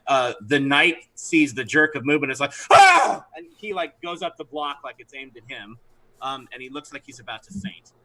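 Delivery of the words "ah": sharp high startled yelp. Acted. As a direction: yelp as the blow comes. Knight startled by attack.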